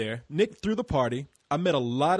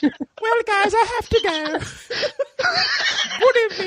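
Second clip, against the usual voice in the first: much higher, laughing, prim farewell; mock prim British goodbye; 5 dB louder than usual. Host voicing Chantel escaping the police, high and laughing. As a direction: bid a polite goodbye while giggling. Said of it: "pretend Chantel fleeing police".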